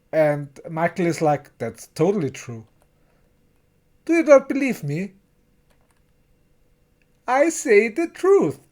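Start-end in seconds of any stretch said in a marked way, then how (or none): 1.6-8.6 higher and faster, playfully insisting; retelling Michael's defiant reply, mock insistent. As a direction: rush it out, pleading to be believed. Michael insists in retold argument.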